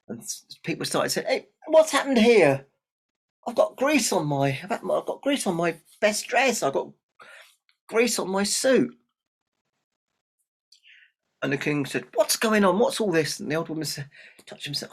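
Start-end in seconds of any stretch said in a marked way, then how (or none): 1.3-2.7 high, loud indignant shout; alarmed outcry. Acted. Guests find grease on clothes. 3.5-8.9 high, loud flustered protests; indignant complaint. Acted. Guests complain of grease. 12.1-13.4 loud, higher-pitched demand; demanding king. Acted.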